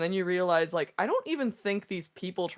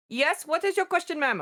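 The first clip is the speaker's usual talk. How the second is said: high, sugary call-center politeness; scam caller voice; 11 semitones higher than usual. Retold or imitated. Retelling the scam caller's sweet reply in a high voice. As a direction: lightly mimic an overly polite scammer. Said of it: "And she's like".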